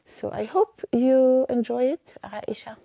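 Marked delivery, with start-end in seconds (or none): none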